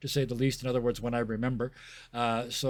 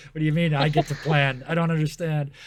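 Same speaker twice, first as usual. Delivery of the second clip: louder, raised-pitch mock confusion; mock bafflement; 4 semitones higher, 8 dB louder than usual. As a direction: protest like a baffled player, exaggerated. Imitating a baffled new player.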